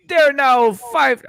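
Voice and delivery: loud, slow Jamaican-sounding slip; accidental Jamaican accent, loud; botched British turning Jamaican. Pretend. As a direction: aim for British and land in Jamaican. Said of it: "Why are you Jamaican?".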